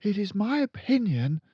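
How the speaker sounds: put-on high, polite young teacher; high pleading teacher; timid teacher. A narrator's reading. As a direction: stand firm though nervous. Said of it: "Miss Honey said".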